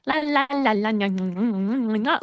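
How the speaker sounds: put-on random la-la babble like a restless child; imitating a son's silly noises; annoying kid noises. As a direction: babble nonsense la-las to irritate. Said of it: "like, making these, like, noises".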